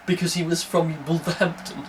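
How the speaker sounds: ordinary talk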